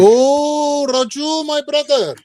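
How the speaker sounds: high, hammed-up Turkish accent greeting. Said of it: "No, that was my Turkish, Turkish accent".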